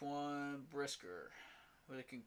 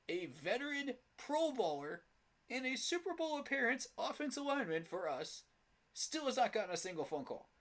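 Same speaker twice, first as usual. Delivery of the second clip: sarcastic disbelief; 7 semitones higher than usual. Real, not acted. Sarcastic mock discovery of snub.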